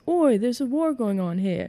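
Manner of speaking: high British call; British accent. Acted. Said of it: "if I could do a British accent".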